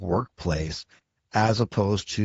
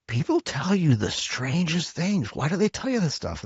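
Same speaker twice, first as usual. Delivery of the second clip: puzzled disbelief, pitched well up; baffled wife; 8 semitones higher than usual. Retold or imitated. Wife asking why strangers open up, baffled. Quoting his wife's baffled question.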